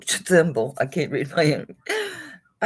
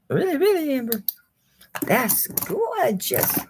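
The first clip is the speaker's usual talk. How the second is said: delighted; 9 semitones higher, slower than usual. Real, not acted. Cooing over the amber heart.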